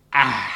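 loud contented ahh after a sip; satisfied sigh. A narrator's reading. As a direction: sigh with pleasure over the beer. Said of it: "seufzte der Zwerg".

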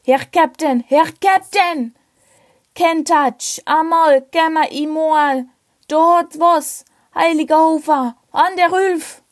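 high, frantic Tyrolean voice over a loudspeaker; alarmed call over the ship's speakers; loudspeaker announcement. Acted. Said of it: "von einer Lautsprecherdurchsage unterbrochen".

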